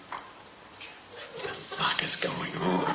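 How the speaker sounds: slow hushed disbelief; stunned parents. Retold or imitated. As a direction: breathe it in shock. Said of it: "my parents are going".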